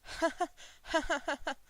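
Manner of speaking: loud high unhinged laughter; boisterous laugh. A narrator's reading. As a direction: burst into a wild boisterous laugh. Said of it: "He was laughing boisterously".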